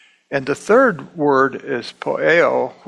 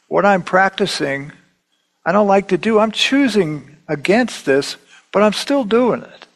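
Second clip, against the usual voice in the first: higher, louder pleading confession; exasperated Paul; 6 semitones higher, 3 dB louder than usual. Retold or imitated. Preacher voicing Paul, exasperated at his own failings. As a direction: plead it like a man stuck in his habits. Voicing Paul's inner struggle.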